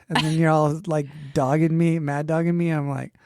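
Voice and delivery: normal talking voice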